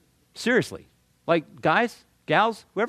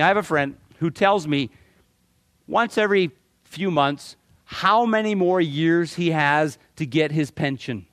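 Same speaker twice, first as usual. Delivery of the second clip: ordinary delivery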